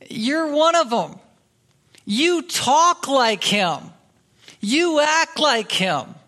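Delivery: high, insistent, drawn-out accusing; acted accusation. Bystanders confront Peter as a follower, high-pitched accusation.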